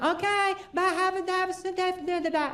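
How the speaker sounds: high, singsong mock wife's goodbye; imitated wife. Wife answers from the next room, imitated in a high voice. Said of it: "She'd be like".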